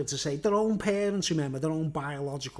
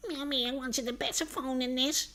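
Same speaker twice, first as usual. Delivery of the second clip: higher, softer spoiled-brat whine; whiny kid; 7 semitones higher, 4 dB quieter than usual. Retold or imitated. Spoiled kid to mum about the phone, higher whining. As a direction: complain like an ungrateful child. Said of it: "little bastards going".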